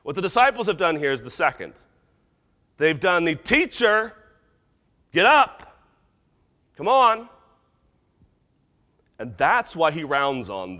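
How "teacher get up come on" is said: loud, high, entitled shouts; fist-shaking demand. Acted. Disciples to their sleeping teacher, angry demand. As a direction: order him up in separate impatient bursts. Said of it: "fist shaking".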